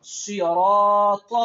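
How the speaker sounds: صراط stretched very slowly, loud and heavy; slow loud teacher model of صراط; heavy drawn-out صراط. Shown as a demonstration. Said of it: "اب پڑھیں".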